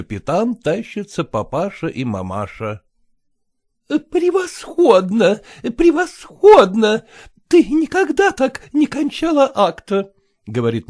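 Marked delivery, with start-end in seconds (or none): 3.9-5.4 high, slow gushing praise; rapturous. Acted. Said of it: "восторженно потрясая лентами". 5.8-7.0 high, louder, rising gush; rapturous, louder. Acted. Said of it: "восторженно потрясая лентами". 7.5-10.1 rapturous. Acted. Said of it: "восторженно".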